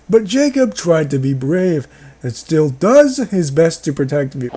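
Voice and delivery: usual speaking voice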